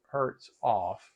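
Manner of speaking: ordinary talk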